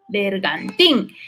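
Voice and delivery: "Bergantín" drawn out slowly, weight on the final "tín"; stress on last syllable. Shown as a demonstration. Teacher showing students where the accent falls, "Bergantín" stressed on "tín". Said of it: "Lleva el acento en la".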